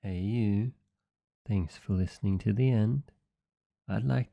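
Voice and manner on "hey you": ordinary delivery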